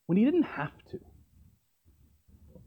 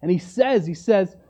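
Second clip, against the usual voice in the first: normal talking voice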